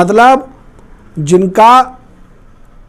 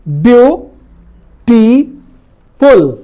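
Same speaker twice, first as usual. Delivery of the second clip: "Beautiful" broken into three slow, separated syllables; 3 semitones higher, much slower than usual. Shown as a demonstration. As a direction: split "beautiful" up with long pauses between the syllables. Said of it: "इस तरह से एक से अधिक syllable हैं".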